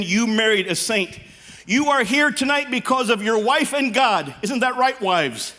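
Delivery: ordinary delivery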